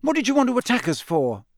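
high-pitched indignant protest. A narrator's reading. Ron rubbing his elbow, facing his father, indignant. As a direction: complain at being wronged. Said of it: "looking indignantly at his father".

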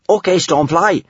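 high, eager command; high-pitched take-charge character; bossy young adventurer. A narrator's reading. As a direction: call out the order in a high voice. Child heroine ordering her dragon.

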